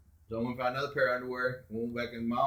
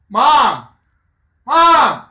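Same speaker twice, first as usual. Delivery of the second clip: loud high shout for Mom; calling Mom; 14 semitones higher, 18 dB louder than usual. Retold or imitated. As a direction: yell for your mother from the kitchen. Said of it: "and I was like".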